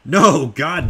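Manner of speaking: ordinary delivery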